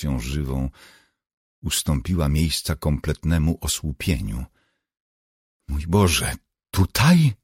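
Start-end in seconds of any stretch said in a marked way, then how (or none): 5.7-7.3 stunned disbelief. A narrator's reading. Ginny reacts to her friend's disclosure, stunned. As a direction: ask it in startled disbelief.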